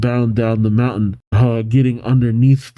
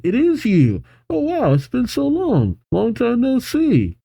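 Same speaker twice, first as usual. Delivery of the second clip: much higher happy cries; joyful reunion; 12 semitones higher than usual. Acted. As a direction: greet a long-lost friend with delight. Pearl reunites with old friend Luxray.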